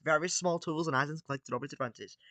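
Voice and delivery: ordinary delivery, nothing marked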